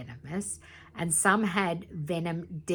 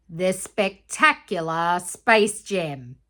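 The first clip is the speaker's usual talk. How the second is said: slow, louder showman announcement; grand reveal; 8 dB louder, much slower than usual. A narrator's reading. Narrator unveiling the Space Gem, slow and grand. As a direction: announce it like a ringmaster.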